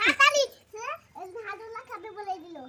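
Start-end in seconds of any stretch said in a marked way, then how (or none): 0.1-0.4 one word shouted out loud; sudden shout. Real, not acted. Child shouting cat's supposed word.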